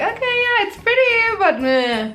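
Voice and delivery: high, unimpressed mock voice; a bored shrug in a high voice; bored meh. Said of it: "You're like".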